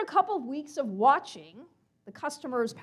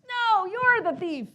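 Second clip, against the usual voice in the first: higher, louder, slow retort; yelling back; 7 semitones higher, 5 dB louder, slower than usual. Acted. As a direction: shout the accusation right back. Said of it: "With all the yelling and the screaming".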